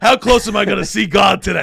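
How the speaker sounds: loud, high excited shout; excited yell. Retold or imitated. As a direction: holler it mid-jump. Jumping in the lake, yelling.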